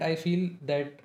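normal talking voice

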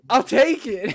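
loud, high burst of relief; excited outburst. Retold or imitated. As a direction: blurt it out, thrilled. Relieved outburst after being rescued.

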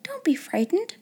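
put-on high, gentle little-girl voice; high and kindly; friendly reassurance. A narrator's reading. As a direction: soothe a frightened child. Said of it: "as if she had been speaking to a little girl like herself".

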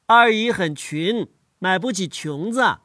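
slow Lanzhou accent, 裙子 said as 琼子; a Lanzhou accent with nasals swapped, slowly. Shown as a demonstration. Child shows grandpa the Lanzhou accent, slow. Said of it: "比方说".

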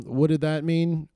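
ordinary talk

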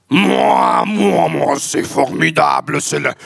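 hammed-up French jingle. Jingle intro done live on request.

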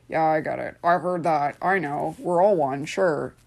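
glib, half-listening agreement; offhand, going through the motions; dismissive. Acted. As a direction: brush it off as already known. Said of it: "If I'm like".

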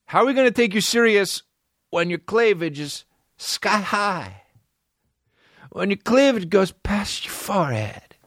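mock her with exaggerated disbelief; mocking jeer. Real, not acted. Host ridiculing the actress's outfit.

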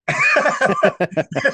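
high-pitched burst of laughter; real high, amused laugh. Real, not acted. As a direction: crack up at your own story. Laughing at own retold story.

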